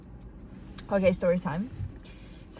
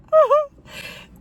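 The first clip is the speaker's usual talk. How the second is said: high loud laugh; 10 dB louder than usual. Real, not acted. Laughing at doctor's cross.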